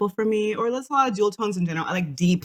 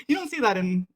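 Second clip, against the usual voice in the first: ordinary talk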